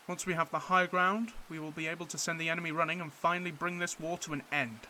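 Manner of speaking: loud, firm officer addressing his men; rally the troops with command; commanding officer briefing. Acted. Officer briefing his men before the bombardment, loud and firm.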